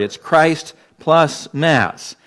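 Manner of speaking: Christ plus Mass said word by word, slow; spelled out slowly. Shown as a demonstration. Preacher breaking the word apart for the church, slow and clear. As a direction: lay out each part slowly. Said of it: "It is a compound word".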